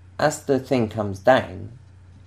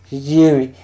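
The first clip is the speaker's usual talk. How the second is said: put-on loud, high drunken call; 7 semitones higher, 12 dB louder than usual. Drunk fan hailing his snooker hero, drunken shout. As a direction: holler the name like a happy drunk. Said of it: "in a pretty drunken voice".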